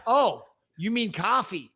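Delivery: slow imitation of an American server; American accent. Drive-thru server finally understanding the order, American accent. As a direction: say "coffee" with an open American vowel. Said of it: "a slight American twang".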